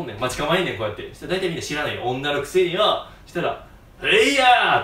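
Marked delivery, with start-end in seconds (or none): 4.0-4.8 high, loud battle cry with a laugh; mock karate yell. Ran throwing the criminal with a loud mock karate yell.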